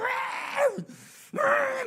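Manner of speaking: high, loud imitation of tearful bawling; hammed-up screaming cry; desperate mock wail. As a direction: reenact wailing and fighting in prayer. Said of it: "기를 쓰고 싸웠거든요".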